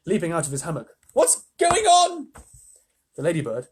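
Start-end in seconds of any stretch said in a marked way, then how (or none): 1.1-2.3 very high, slow, loud question; alarmed cry. Acted. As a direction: call it out bewildered. James alarmed.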